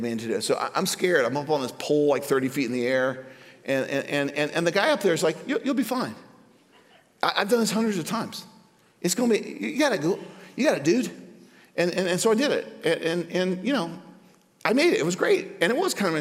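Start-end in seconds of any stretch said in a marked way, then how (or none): 5.5-11.1 lightly voiced guide. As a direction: coax a scared man off the pole. Zip line guide reassuring.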